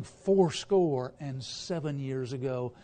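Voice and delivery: slow, solemn recitation of fourscore; slow stately oratorical phrasing; eloquent oratory. Shown as a demonstration. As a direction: savour each word like scripture. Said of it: "eloquence of saying".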